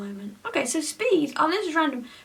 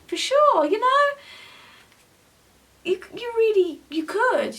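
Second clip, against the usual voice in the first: emphatic; 8 semitones higher, 5 dB louder than usual. Real, not acted. Emphatic encouragement.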